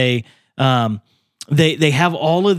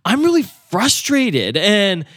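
higher, pleading prayer voice; higher-pitched, frustrated and scared prayer; frustrated plea; 6 semitones higher than usual. Retold or imitated. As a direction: pour out frustration and fear to the Lord. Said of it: "some thoughts or some feelings like".

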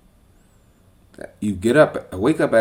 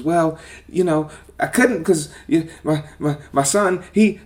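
higher, fumbling put-on young woman voice; mocking her excuses; 8 semitones higher than usual. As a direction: stammer her excuses, pitched up and whiny. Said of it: "she started giving me all these excuses".

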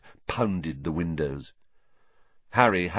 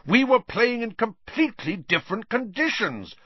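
high-pitched, agitated cry; worked-up, emphatic protest; shouting; 3 dB louder than usual. A narrator's reading. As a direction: shout a frantic correction, pitch way up. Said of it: "Wood shouted".

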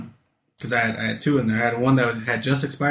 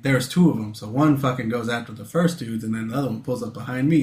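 ordinary talk, nothing marked

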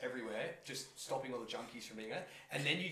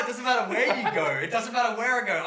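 high loud laughing, half shouted; laughing rant; 10 semitones higher, 14 dB louder than usual. Retold or imitated. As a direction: laugh it out at yourself. Laughing self-reproach.

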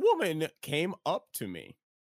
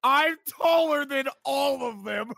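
higher, slow, almost shouting through laughter; laughing excitement; 9 semitones higher, 4 dB louder than usual. Real, not acted. Kai to friends, laughing loud brag. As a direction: crow it out, delighted.

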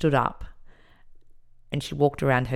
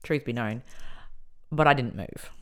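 ordinary delivery

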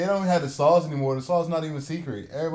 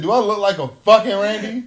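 loud, higher indignant retort; mock indignant; 8 semitones higher, 9 dB louder than usual. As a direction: snap back offended at the counter. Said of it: "I said".

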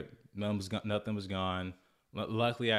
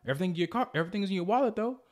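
much higher, louder, fast angry tirade imitating the thief; cussing him out; 12 semitones higher, 3 dB louder, faster than usual. The thief cussing him out, high and loud. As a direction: spit the insults out fast and high. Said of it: "he starts cussing me out".

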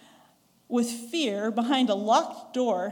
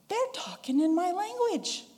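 amazed crowd; 7 semitones higher than usual. Acted. Crowd marvels.

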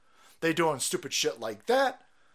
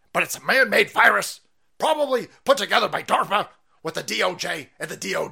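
louder, a light Alex Jones rant; Alex Jones impression; 5 dB louder than usual. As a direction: ham up a conspiracy radio host. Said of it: "Alex Jones was saying".

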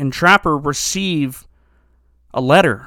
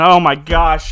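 normal talking voice

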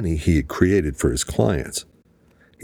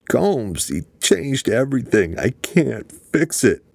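weeping; 8 semitones higher than usual. A narrator's reading. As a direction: voice breaking with tears over a dead end. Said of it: "he wept".

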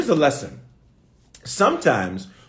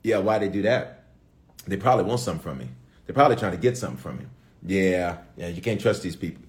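lower, quick suspicious mutter; suspicious hard-hearted voice; 8 semitones lower, 3 dB quieter, faster than usual. Retold or imitated. Preacher voicing a hardened heart, low suspicious grumble. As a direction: grumble like someone who trusts no one. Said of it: "I'd look at this and say".

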